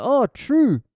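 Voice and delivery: high, loud New Zealand "true"; Kiwi accent. Retold or imitated. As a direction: chirp it like a Kiwi bloke. Voicing the Kiwi dad.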